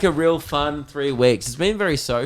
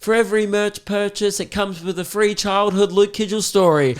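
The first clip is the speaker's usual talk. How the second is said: higher, market-stall call; merch hawker; 7 semitones higher than usual. Retold or imitated. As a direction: sell it like a street vendor. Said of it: "He's like".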